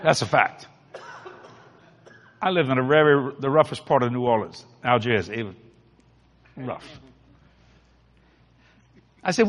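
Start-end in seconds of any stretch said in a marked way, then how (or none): none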